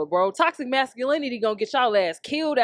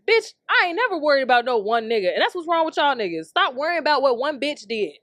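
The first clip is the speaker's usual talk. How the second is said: usual speaking voice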